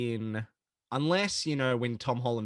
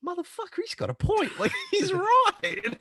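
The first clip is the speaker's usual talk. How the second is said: much higher, laughing squeak; high squeaky voice. Retold or imitated. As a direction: squeak it out in disbelief. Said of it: "Eddie Brock's just standing there like".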